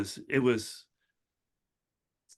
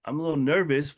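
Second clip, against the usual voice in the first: shy nervous person; 4 semitones higher, slower than usual. Retold or imitated. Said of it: "be shy about it like I was".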